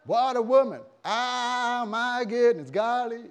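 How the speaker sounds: loud, high Southern American exclaiming; thrilled Southern American suitor; Southern American excitement. Acted. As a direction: gush in a hammed-up Southern American accent. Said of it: "Southern American".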